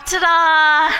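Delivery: loud, high, held-out flourish; showy fanfare answer; triumphant ta-da. Shown as a demonstration. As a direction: burst out with a big ta-da. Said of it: "you now have a go-to phrase".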